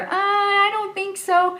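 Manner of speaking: a wavering, unsure refusal; soft no. Shown as a demonstration. Friend declining the party ask, hesitant soft no. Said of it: "that's a soft no".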